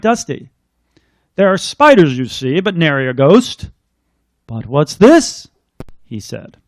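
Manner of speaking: put-on lilting read-aloud for children; storybook reading. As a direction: read it like a bedtime rhyme. Reads picture book aloud.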